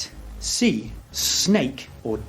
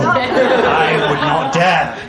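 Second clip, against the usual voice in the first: mock horror; 2 semitones higher, 5 dB louder, much slower than usual.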